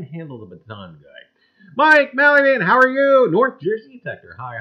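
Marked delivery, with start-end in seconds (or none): 1.8-4.4 high, loud greeting to a viewer; sing-song hello to a chat viewer; calling out. Real, not acted. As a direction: call the name out brightly.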